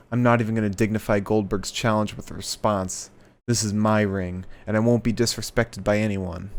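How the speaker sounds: ordinary talk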